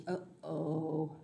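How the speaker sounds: low, soft worried uh-oh; a hushed, low note of trouble. Acted. Reader reacts to the incident.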